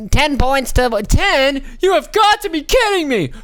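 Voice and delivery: outraged shout. Acted. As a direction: scream your disbelief at the score.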